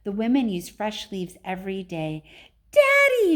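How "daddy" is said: a piercing high shout of "Daddy". Acted. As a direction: yell for daddy like a spoilt girl. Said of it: "shouted Veruca Salt".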